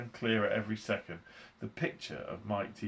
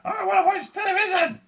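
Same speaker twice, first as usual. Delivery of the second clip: put-on very high, loud tiny-boy scream; piercing shrunken-child shriek; screaming tantrum; 12 dB louder than usual. A narrator's reading. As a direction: throw a squeaky tantrum. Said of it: "He screamed".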